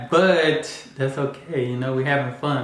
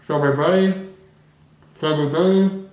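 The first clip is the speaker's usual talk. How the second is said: words mushed through stuffed cheeks; mouth full; 4 semitones higher than usual. Real, not acted. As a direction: say it around a mouthful of marshmallows. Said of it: "My mouth was so full".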